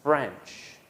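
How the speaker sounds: French as one clear syllable with a falling tune; model pronunciation. Shown as a demonstration. Teacher modelling French for students to repeat. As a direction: model French carefully for repetition. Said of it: "And one last time".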